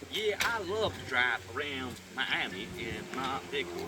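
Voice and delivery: slow, hammed-up Southern US drawl; mock a proud Southern truck driver in a Southern accent; silly Southern accent. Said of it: "recording that silly accent".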